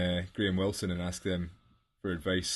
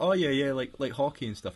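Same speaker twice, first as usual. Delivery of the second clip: high, bright put-on voice of the girl, louder; airily brushing him off, higher and louder; mock breezy girl; 7 semitones higher, 4 dB louder than usual. Retelling a chat with a girl, mock breezy high voice. Mimicking the girl's casual replies.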